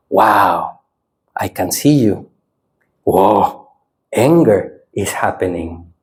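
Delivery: low, unhurried noting with pauses; calm observing. Shown as a demonstration. Teacher showing the second experiment, calm observing. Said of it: "Next experiment".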